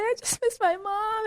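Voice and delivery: high, slow, crying voice; imitating herself sobbing for her mom; tearful mock whimper. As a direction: squeak it out like you're about to bawl. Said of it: "I was like".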